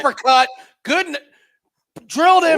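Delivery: rising excited shout; excited yell. Real, not acted. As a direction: call the big shot loud. Calling a knockdown live.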